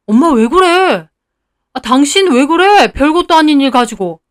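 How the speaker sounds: mildly irritated appeal; exasperated protest. A narrator's reading. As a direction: plead with them to stop fussing. Husband protests at his mother's outburst.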